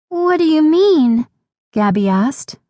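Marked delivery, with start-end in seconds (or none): 0.1-1.3 put-on high child's anxious question; worried little girl. A narrator's reading. Little sister worries about her nose, high anxious question. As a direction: ask anxiously in a small high voice. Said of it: "looking worried".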